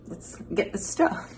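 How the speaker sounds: slow, breathy whispered repeat. Pretend. Repeating the line to viewers as a slow whisper. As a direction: whisper it like a secret.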